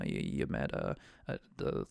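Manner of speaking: ordinary talk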